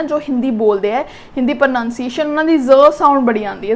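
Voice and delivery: usual speaking voice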